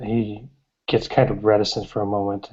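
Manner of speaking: normal talking voice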